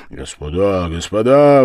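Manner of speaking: ordinary delivery, nothing marked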